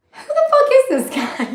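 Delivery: high-pitched laughing disbelief; a laughing high squeal of disbelief at the psychiatrist; incredulous squeal. Retold or imitated. Incredulous aside about psychiatrist.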